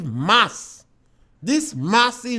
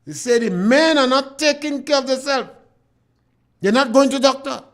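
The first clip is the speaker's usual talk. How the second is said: usual speaking voice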